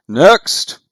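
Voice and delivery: loud, high shout down the line; yelled call. Acted. Boatman summons the next in line, yelling out. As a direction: call out sharply for the next person. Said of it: "you hear him yell out".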